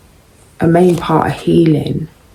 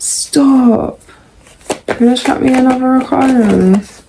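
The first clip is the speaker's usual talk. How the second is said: high-pitched, incredulous reaction to the cards; playful high-voiced protest; mock disbelief; 7 semitones higher than usual. Real, not acted. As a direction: exclaim it high, as if you can't believe the draw. Reacts to another arcana card.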